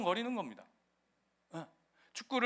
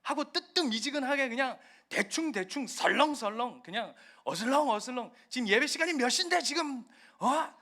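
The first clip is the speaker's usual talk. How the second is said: higher, building to a loud furious scold; angry God scolding; 6 semitones higher, 4 dB louder than usual. Acted. Acted God berating lukewarm churchgoers, rising anger. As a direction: tear into late, lazy worshippers. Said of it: "연기입니다".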